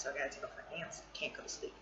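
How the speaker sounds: quick, soft confiding mutter; whispered confession; hushed mutter. Real, not acted. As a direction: drop to a near whisper. Muttered confession about pants.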